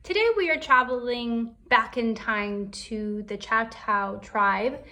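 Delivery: plain reading voice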